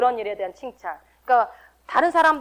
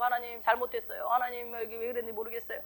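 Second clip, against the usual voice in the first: repentant prayer; 11 dB quieter than usual. Retold or imitated. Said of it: "그리고".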